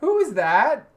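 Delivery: high, loud, incredulous; asking his girlfriend who she is, in mock shock; mock astonishment. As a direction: act stunned at who she's become. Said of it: "and I go".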